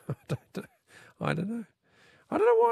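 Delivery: laughing it off, voice jumping up. Real, not acted. Host laughing at a listener's joke about Tarzan's wives. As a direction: laugh through the words in disbelief.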